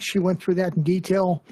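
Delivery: ordinary talk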